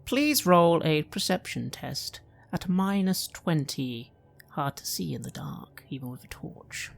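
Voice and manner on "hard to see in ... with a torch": hushed, breathy whisper in the dark. A narrator's reading. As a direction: whisper it like a secret. GM sets a dark scene.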